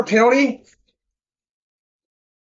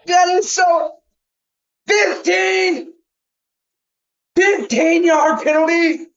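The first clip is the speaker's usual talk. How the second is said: outraged shout; 8 semitones higher, slower than usual. Real, not acted. Outraged at penalty call.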